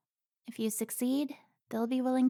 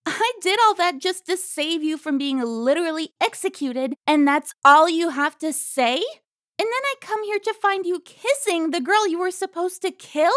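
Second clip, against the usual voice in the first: loud, higher, rising anger; furious rant; 5 semitones higher, 8 dB louder than usual. Acted. Carmen berating Alfonso for his betrayal, loud and furious. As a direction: tear into him.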